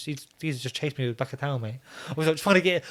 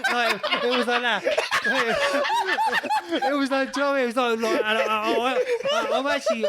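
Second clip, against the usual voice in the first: higher voice breaking into laughter; cracking up; 8 semitones higher than usual. Real, not acted. Telling friends how he got hit, cracking up. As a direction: try to talk through a laughing fit.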